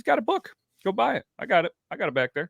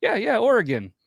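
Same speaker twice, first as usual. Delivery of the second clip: eager confirmation; 11 semitones higher, 3 dB louder than usual. Retold or imitated. Confirming the Oregon answer.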